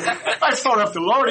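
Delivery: put-on high, slow boy voice, half-laughing; sheepish boy confessing. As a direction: confess it like a mortified kid. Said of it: "He goes".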